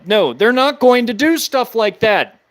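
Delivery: ordinary talk, nothing marked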